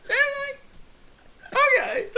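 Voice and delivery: mock-whine like a betrayed kid; whiny protest. Telling friends how he reacted to the spiking, high whine. Retold whining at pranking friends.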